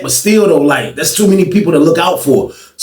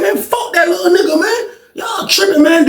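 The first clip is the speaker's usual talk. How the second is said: laughing scoff; 13 semitones higher than usual. Retold or imitated. Teller brushing off his friends, high laughing scoff. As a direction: laugh it off dismissively.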